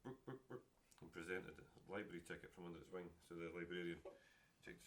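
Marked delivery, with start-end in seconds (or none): 0.0-0.6 high clucking hen going book book book; chicken clucking. Acted.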